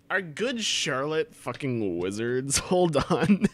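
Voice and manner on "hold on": ordinary delivery, nothing marked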